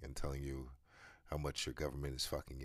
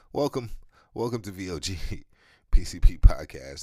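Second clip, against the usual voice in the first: loud, hyped podcast welcome with a laugh; show intro; 3 semitones higher, 15 dB louder than usual. Acted. Host opening his podcast to listeners, loud announcer welcome. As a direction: belt out the show's opening like an announcer. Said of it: "That's how it starts".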